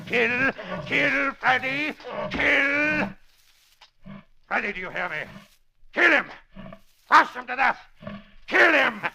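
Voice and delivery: high, urgent killing orders; a villain urging his gorilla to kill; frenzied commands. Acted. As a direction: egg the beast on.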